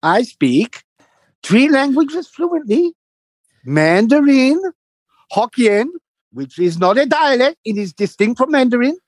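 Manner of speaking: high, slow boastful lecture; hammed-up proud immigrant man; mimicking the neighbour. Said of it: "He goes".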